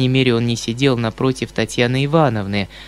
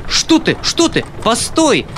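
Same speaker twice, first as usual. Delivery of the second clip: alarmed cry; 10 semitones higher than usual. Acted. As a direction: try to stop them. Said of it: "вскричал было дядя".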